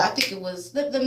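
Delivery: ordinary delivery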